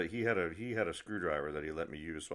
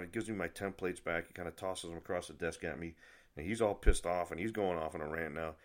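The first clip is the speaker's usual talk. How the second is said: normal talking voice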